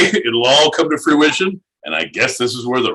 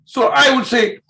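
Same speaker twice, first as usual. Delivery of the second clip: broken-English Russian voice, dropped articles; hammed-up Russian accent. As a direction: play a boastful Russian. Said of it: "in a Russian accent".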